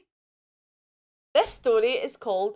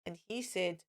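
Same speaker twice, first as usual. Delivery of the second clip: ordinary delivery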